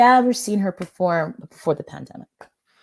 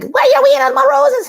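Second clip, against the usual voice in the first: little man voice; 7 dB louder than usual. Retold or imitated. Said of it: "And then the little man's like".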